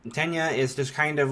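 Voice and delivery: normal talking voice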